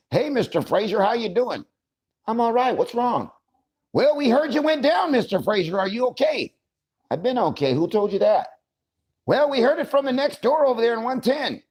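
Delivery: high, hammed-up nurse and patient voices; playful high-voiced role play; mock hospital dialogue. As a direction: play both sides in high put-on voices.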